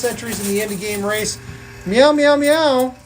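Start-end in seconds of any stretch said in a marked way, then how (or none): 1.8-2.9 cat meows. Pretend. Streamer meowing to viewers in cat, playful. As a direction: meow like a cat for the chat. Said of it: "For those of you who don't speak cat".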